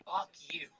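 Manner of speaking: mock cursing under the breath; imitated mouthed retort. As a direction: act out mouthing it, barely voiced. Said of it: "He goes".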